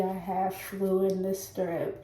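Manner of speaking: blocked nose, sick voice; stuffy nose. Real, not acted. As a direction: talk through a stuffy cold. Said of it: "I'm sorry if I sound, like, stuffy".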